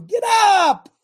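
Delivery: high, loud urging that builds to a shout; impassioned rallying holler; exhorting. Shown as a demonstration. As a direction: drive them up with sheer force. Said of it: "I'm exhorting you".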